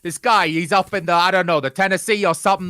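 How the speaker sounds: hammed-up New Yorker griping about a seller; a weak stab at a New York accent; terrible New York accent. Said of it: "I do a terrible New York accent".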